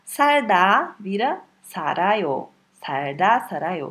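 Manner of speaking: sarda vira sarayo devagar, sílaba por sílaba; slow demo. Shown as a demonstration. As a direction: dita lentamente para o aluno ouvir. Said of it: "O que acontece?". (demonstração lenta de professor)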